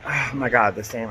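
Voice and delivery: ordinary talk, nothing marked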